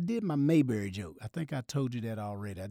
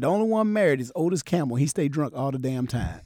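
loud, high punchline delivery; stand-up punchline; 4 semitones higher, 10 dB louder than usual. Retold or imitated. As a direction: belt it out to the crowd. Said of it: "I said".

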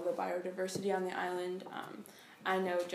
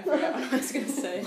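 laughing; 4 semitones higher, 8 dB louder, faster than usual. Real, not acted. Flustered after losing her thought.

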